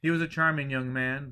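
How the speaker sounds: plain reading voice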